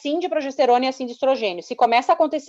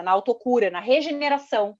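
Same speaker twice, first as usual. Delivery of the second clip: usual speaking voice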